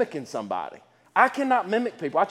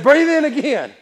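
high, loud repeat cue; raised, rallying command; calling out instruction; 8 semitones higher, 7 dB louder than usual. Retold or imitated. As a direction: retell the preacher calling the room to inhale. Leading crowd in breathing exercise.

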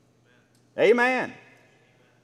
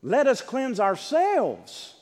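"Let us cleanse ourselves" drawn out slowly and higher; emphatic verse reading; 4 semitones higher, much slower than usual. Shown as a demonstration. Preacher quoting the apostle's phrase to congregation, slow and emphatic. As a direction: stretch "ourselves" slowly, high then falling.

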